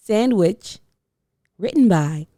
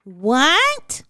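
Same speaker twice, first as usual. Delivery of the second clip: surprised; 5 semitones higher than usual. A narrator's reading.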